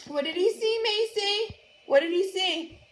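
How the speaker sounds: ordinary delivery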